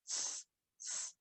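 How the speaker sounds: hissed s sounds, unvoiced; whispered s hiss. Shown as a demonstration. Teacher demonstrating the letter sound, a soft hiss. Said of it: "same sound as the J".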